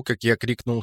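ordinary delivery, nothing marked

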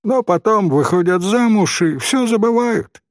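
put-on high-pitched, caustic boy; mildly snide child's retort; sarcastic. A narrator's reading. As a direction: jeer sceptically. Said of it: "язвительно заметил мальчик".

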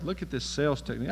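normal talking voice

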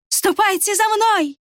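high, bright call, slow; ringing inviting voice. A narrator's reading. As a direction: call them sweetly. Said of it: "Голос прозвенел, точно весенняя капель".

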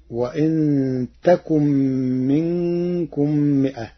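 تكن with ta; slow recited تكن starting with ta; Warsh reading. Shown as a demonstration. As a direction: say تكن with a ta instead of ya. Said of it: "فتصير".

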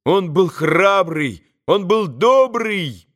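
high, slow exclamations; boys crying out. A narrator's reading. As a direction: cry out praise like fervent boys. Boys keep crying out praise.

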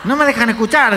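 high, loud acted complaint; mock exasperation. Moviegoer who cannot hear the film, loud mock complaint. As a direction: imitate a fed-up moviegoer calling out.

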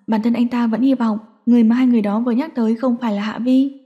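ordinary delivery

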